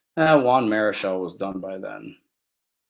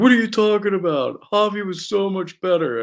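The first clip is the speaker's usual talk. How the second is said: indignant fan; 9 semitones higher, 4 dB louder than usual. Retold or imitated. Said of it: "And they're like".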